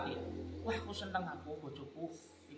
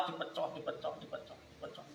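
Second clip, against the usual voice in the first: each dipecah softer until it dies to a whisper; fading repetition; 7 dB quieter than usual. Acted. Preacher telling of the small chicken pecked, fading to a whisper. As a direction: let the words shrink away to nothing.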